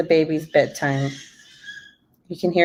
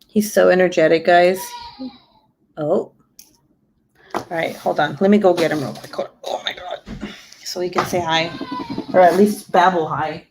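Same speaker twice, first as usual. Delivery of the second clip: ordinary talk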